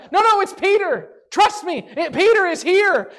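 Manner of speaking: high, loud, eager insisting; excited insisting. Retold or imitated. Rhoda arguing with the disciples, excited and insistent. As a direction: insist breathlessly that he is really here. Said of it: "She's so excited".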